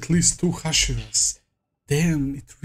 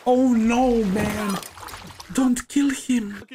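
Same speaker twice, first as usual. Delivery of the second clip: higher-pitched, dismayed cry at the screen; alarmed plea; 6 semitones higher, slower than usual. Real, not acted. Viewer begging the anime fighter be spared, alarmed. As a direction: plead in alarm for the character.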